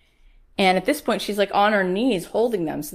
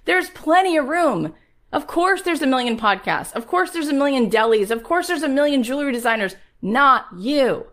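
high, loud fired-up preaching; rally the crowd like a preacher; preaching at full force; 6 semitones higher, 6 dB louder than usual. Acted. Preaching to her audience.

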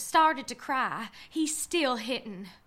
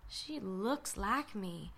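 hushed; 7 semitones lower, 12 dB quieter, slower than usual. Acted. Narrator stunned, fading.

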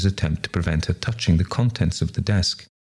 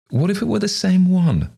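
much higher, with a sly smile; queer smile; 13 semitones higher than usual. A narrator's reading. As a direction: tease a dark hint through a smile. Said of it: "with a queer smile".

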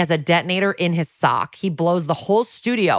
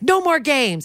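higher-pitched mock outburst; raised-pitch dramatic retelling; retold stern demand; 9 semitones higher, slower than usual. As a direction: play a cop losing patience. Retold armed standoff demand.

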